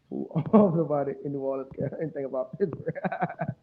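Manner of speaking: talking through laughter; chuckling while talking; laughing. Real, not acted. Laughing at a chat comment.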